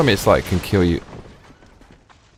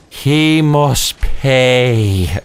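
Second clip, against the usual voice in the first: slow, drawn-out, higher grim oath; imitated McGregor vow; 6 semitones higher, 4 dB louder, much slower than usual. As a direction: deliver it like a fighter swearing revenge. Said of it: "so convincing and so full of emotion".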